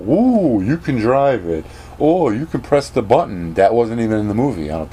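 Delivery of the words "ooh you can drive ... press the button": high, swooping fake excitement; hammed-up wonder; mock amazement. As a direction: gush sarcastically over a dull feature. Mocks excitement over the ride's controls.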